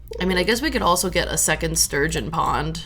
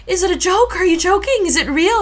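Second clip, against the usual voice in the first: high, loud volley of disbelieving questions; excited grandmother; 13 semitones higher, 5 dB louder than usual. Retold or imitated. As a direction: imitate a grandma too thrilled to believe it. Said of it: "she was so excited".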